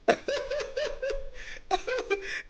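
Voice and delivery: amused laugh. Real, not acted. Builder laughing at being asked when he will stop, amused. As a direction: laugh in a high pitch at the question.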